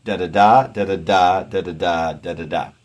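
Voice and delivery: "da-da-da" chanted da-da-DA four times, starting loud; straight anapestic beat. Shown as a demonstration. Teacher scanning an anapestic line, chanted beat. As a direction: beat out each "da-da-da" weak-weak-strong, stepping down. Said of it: "pretty much a straight anapestic line".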